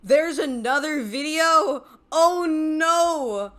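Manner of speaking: loud, high, drawn-out gasp of alarm; shocked outcry. Real, not acted. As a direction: yell it out in sudden alarm. Shocked by news of another video.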